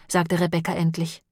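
ordinary delivery

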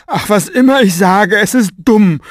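high, loud, blustering apology; flustered strong man. A narrator's reading. Strong man apologizing to Mo, high and loud. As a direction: babble it out, embarrassed and hungover. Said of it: "stöhnte er".

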